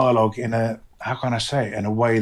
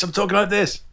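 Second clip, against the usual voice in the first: raised pitch on I'm talking like this; higher pitched; 8 semitones higher than usual. Shown as a demonstration. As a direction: keep it up high. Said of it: "I'm more higher pitched".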